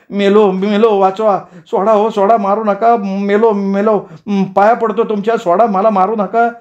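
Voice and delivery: high, wailing pleas; bellowing for mercy. Acted. Beaten young man begs the mob to stop, high desperate wailing. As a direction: cry out as if being beaten to death. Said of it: "तसा तो गुरासारखा ओरडायला लागला".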